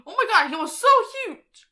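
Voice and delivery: high, loud, fast exclamation; excited amazement. Real, not acted. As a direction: blurt it out in high-pitched amazement. Exclaims over Slimer on screen.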